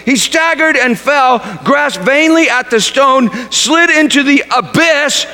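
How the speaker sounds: usual speaking voice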